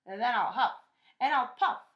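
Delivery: loud, rising to a high threatening cry; wolf's loud threat. A narrator's reading. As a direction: build the threat up to the blow. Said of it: "cried the wolf".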